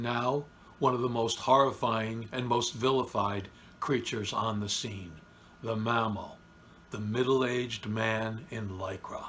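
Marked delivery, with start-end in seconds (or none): none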